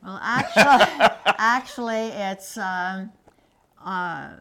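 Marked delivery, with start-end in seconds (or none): 0.0-2.1 laughing. Real, not acted. Laughs before answering question.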